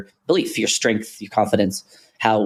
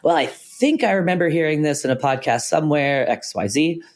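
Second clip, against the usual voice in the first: pitched up, tentative half-memory; higher, unsure inflection; 6 semitones higher than usual. Shown as a demonstration. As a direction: lift your voice high to signal doubt. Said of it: "my voice will go higher".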